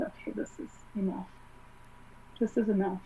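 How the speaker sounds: soft reassuring whisper. Retold or imitated. Dead mom comforting her.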